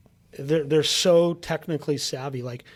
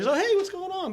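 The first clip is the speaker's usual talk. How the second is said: high eager salesman. Retold or imitated. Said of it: "the guys were like".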